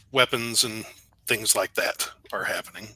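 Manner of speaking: usual speaking voice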